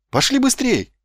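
high, eager cry; impatient rallying call; urgent exclamation. A narrator's reading. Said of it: "воскликнул он".

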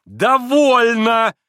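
high, loud, long-held cry; ringing shout. A narrator's reading. Kolganov covering the card, ringing shout. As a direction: cry out to halt everything. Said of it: "крикнул он своим звонким голосом".

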